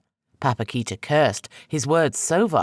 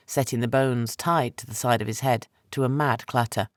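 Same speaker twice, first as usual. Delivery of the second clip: ordinary delivery, nothing marked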